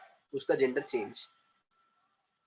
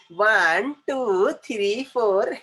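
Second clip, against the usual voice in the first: high, drawn-out singsong count; mocking childish counting; 5 semitones higher, 4 dB louder, slower than usual. Teacher mimicking how some people start counting, high and singsong. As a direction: imitate a little child counting aloud. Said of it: "वो यहाँ से start करते हैं".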